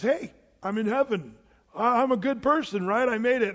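lightly voiced hopeful sinner, pleased with himself; cocky dead man. Dead man at heaven's gate, eager and a bit smug.

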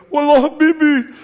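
high, loud mock Arabic greeting "Wallah, Habibi"; playful Arabic-accented hail; Middle Eastern greeting. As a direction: call out like a friendly street greeting in the Middle East. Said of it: "the greetings go".

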